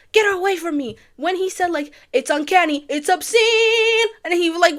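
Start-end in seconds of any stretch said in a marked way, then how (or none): 0.1-1.0 high, loud yelled rejection; angry yell. Retold or imitated. Dad rejecting his newborn, imitated yelling. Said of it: "He yells". 2.1-4.1 high, loud, mounting outcry; imitated yelling. Father rejecting his green baby, imitated loud yelling. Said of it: "was yelling".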